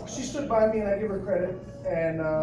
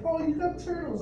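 higher, lightly voiced mom, delighted; a hint of a cheerful mom; imitating mom; 11 semitones higher than usual. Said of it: "My mom's like".

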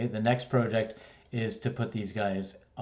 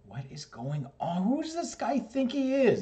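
much higher, incredulous voice; exasperated disbelief. Retold or imitated. Student inwardly outraged at the teacher, high incredulous disbelief.

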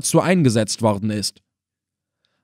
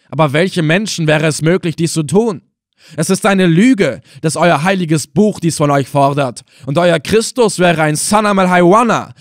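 loud, furious accusation hurled at his captor; angry outburst; 4 semitones higher, 4 dB louder than usual. Acted. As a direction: spit the words out in rage, louder and higher. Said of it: "zornig herausstieß".

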